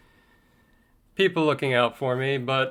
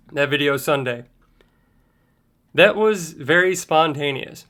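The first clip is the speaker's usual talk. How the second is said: ordinary talk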